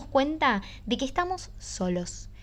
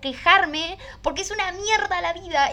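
mock wailing; 8 semitones higher than usual.

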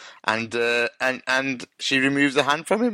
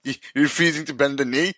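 laughing; 2 semitones higher than usual. Real, not acted. Teasing, laughing.

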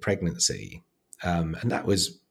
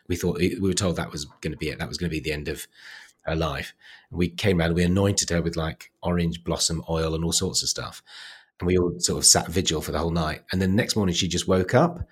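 ordinary delivery

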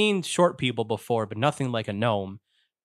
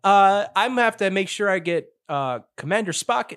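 high put-on voice, starting loud; hesitant officer turning down a request; wary crewman refusing; 7 semitones higher, 4 dB louder than usual. Crewman refuses to open the tube.

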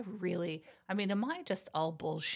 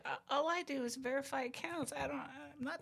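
meek employee; 7 semitones higher, 7 dB quieter than usual. Retold or imitated. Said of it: "These people are like".

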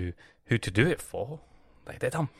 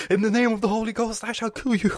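raised, loud mock holy warrior; mock terrorist; 8 semitones higher, 6 dB louder than usual. Comic mimics a fanatic declaring his kill, loud and raised.

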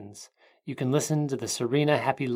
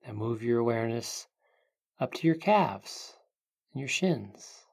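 guided meditation; 3 dB quieter than usual. Acted. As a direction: ease them into calm, unhurried.

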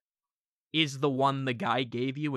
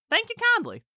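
chirpy mascot; 3 dB louder than usual. Acted. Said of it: "God damn, well, I guess all I gotta say".

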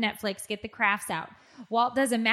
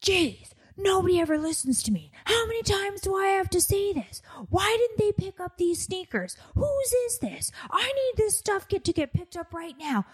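high, exasperated nagging voice; mock the fed-up parent nagging about mess; nagging husband impression; 9 semitones higher than usual. Mocking husband nagging about chores, high exasperated voice. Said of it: "he's walking around the house and he's like".

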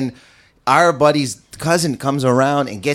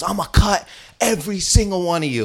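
higher put-on voice, mock menace; menacing thug; 6 semitones higher than usual. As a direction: threaten them like a tough guy, half joking. Knife guy threatens group.

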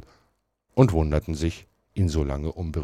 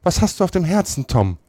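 besorgte Tante; 13 semitones higher, 3 dB louder than usual. A narrator's reading. Tante Polly fragt besorgt.

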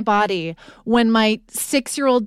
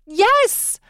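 excited; 10 semitones higher than usual. Retold or imitated. Reenacts her excited yes.

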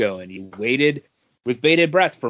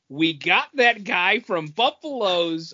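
much higher and louder, pumped-up boss rallying staff; mock excited executive; 8 semitones higher, 4 dB louder than usual. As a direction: hype up the team like a scrappy studio boss. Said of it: "I don't believe that they're going".

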